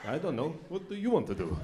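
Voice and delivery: low, dopey-guy huddle voices; imitated big guys, pitched low; mock football players. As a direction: pass the question along in a low put-on voice.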